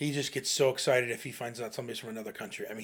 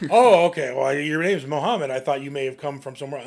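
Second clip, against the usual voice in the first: mock surprise; 8 dB louder than usual.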